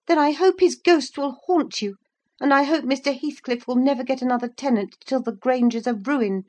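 put-on high, sharp curse from a young woman; sharp answer. A narrator's reading. Woman cursing the men who refuse to help, sharply. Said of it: "she answered sharply".

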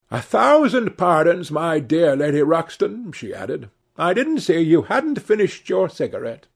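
Lady Narborough. A narrator's reading.

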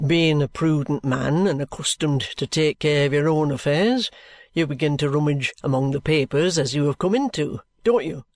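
a hint of a low, knowing police inspector; measured, confiding lawman's voice; lightly voiced detective. A narrator's reading. Detective Bucket explaining the case to Mr Jarndyce, knowing and measured.

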